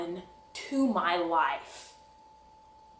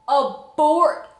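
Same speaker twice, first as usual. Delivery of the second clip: ordinary delivery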